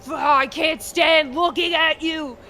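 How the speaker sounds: loud, high, hurtful rage; bitter shouting dad. Retold or imitated. A father blaming his son for the mother's absence, shouting. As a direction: shout the blame at him, slowing at the end. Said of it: "And the guy's like".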